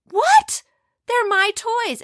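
loud, very high shocked cry; indignant boy shouting back; outraged protest. Acted. Boy outraged toys taken.

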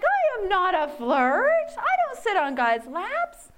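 put-on teen [name] indignantly denying it, high and slow; indignant protest. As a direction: insist hotly, word by word. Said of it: "I was like".

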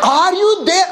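high-pitched imitated holler; mock scream. Wife calling to her husband next door, mock screaming. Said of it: "I could hear her screaming".

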